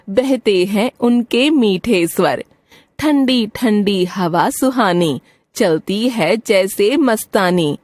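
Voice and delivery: put-on slow, measured reading of a children's rhyme; poem recitation. Teacher reciting a morning poem to the class, slow recitation. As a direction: recite each couplet slowly, line by line.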